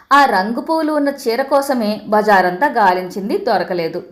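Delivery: plain reading voice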